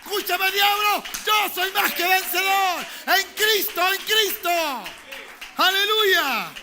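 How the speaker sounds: shouted, high-pitched rallying declaration; full-force preaching. Real, not acted. Preacher urging congregation to declare victory over the devil, full-force shout. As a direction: rouse the whole church at the top of your voice.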